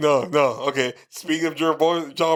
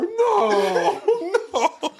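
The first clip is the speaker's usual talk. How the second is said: no squeezed out high through laughter; cracking up while protesting; laughing no. Real, not acted. Laughing at shared memory.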